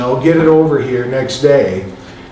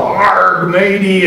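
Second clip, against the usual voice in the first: a quick hammed-up pirate "Arg, matey", pitched higher; mock pirate talk; 5 semitones higher, much slower than usual. Speaker mocks how Penzance locals talk, lightly done pirate voice. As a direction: toss off a jokey pirate accent. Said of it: "They all talk like a bunch of pirates".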